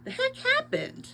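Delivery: a startled, high question; surprised. A narrator's reading. Friend smelling smoke on Teo, surprised and high.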